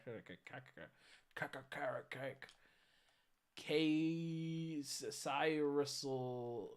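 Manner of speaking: low, halting stabs at Caricio and Cyrissila; struggling to pronounce. Real, not acted. Streamer sounding out an unfamiliar username, struggling. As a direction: stumble through broken syllables, ending in la-la-la. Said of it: "I can't even say it".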